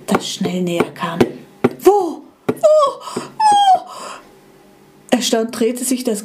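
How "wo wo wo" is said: high, louder rising calls; old iPod frantically calling out; frantic calling. Acted. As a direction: call out searching, each one higher.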